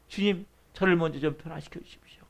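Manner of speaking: soft, near-whispered plea; hushed prayer. Acted. Believer asking the Lord to change them first, hushed pleading. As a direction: let the voice sink into a humble whisper.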